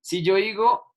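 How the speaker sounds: usual speaking voice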